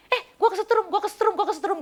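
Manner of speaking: high, loud, shocked repeated cry; startled electrified cry. Retold or imitated. Woman retelling how she shouted during prayer, high and shocked. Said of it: "kayak disetrum sampai saya bilang gini".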